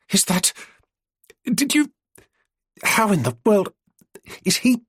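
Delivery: breathless halting questions; out of breath. Acted. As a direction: gasp out the broken questions. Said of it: "She stopped to catch her breath".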